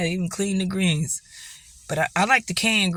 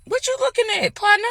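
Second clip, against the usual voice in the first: much higher put-on challenge; mock tough guy; faster than usual. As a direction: play a cocky cowboy calling someone out. Sudden put-on voice to camera.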